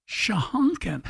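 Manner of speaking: said through a laugh, falling; laughing word of a radio host savoring a funny term. Real, not acted. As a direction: chuckle the odd word out. Host delights in odd word.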